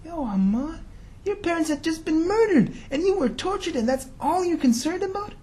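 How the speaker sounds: high, amazed disbelief with a laugh; incredulous. Real, not acted. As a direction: marvel at him, half laughing. Preacher marvels at Ammar.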